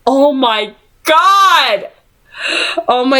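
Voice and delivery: slow, stretched-out oh my god; stunned gasp. Real, not acted. Reader shocked by a fallen friend's name, stretched gasp. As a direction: let the shock land slowly.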